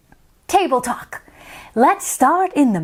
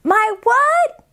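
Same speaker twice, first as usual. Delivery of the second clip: put-on much higher, fast little girl; 8 semitones higher than usual. Isabella introducing herself, high and fast. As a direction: chatter like an excited young girl. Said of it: "Isabella asks".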